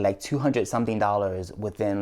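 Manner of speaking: ordinary talk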